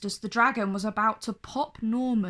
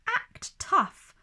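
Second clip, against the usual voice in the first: tough guy voice; 10 semitones higher than usual. A narrator's reading. Bully's motto voiced.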